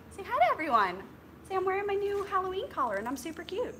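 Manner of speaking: high, quick sing-song voice for the dog; pet talk. Acted. Showing the dog's new collar, high quick pet talk. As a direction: chirp it out for the pup.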